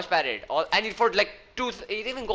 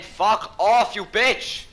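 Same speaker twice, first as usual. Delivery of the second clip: angry shout; 5 semitones higher, 6 dB louder, much slower than usual. Real, not acted. Angry at sniper.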